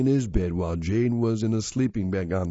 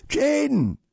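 high-pitched call from behind; ringing call; 4 dB louder than usual. A narrator's reading. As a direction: call out a friend's name, pitch way up. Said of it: "a familiar voice rang out behind him".

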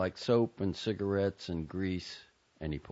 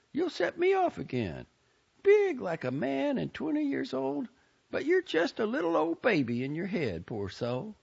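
higher, tearful-tinged Southern aunt; upset Southern aunt. A narrator's reading. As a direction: voice the bitter aunt, pitched up. Aunt Maisie voiced by reader.

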